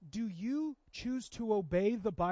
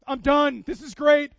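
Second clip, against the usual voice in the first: louder, higher happy declaration; delighted; 6 semitones higher, 16 dB louder, slower than usual. Retold or imitated. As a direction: announce it like you've just won. Dad thrilled, retelling his reaction.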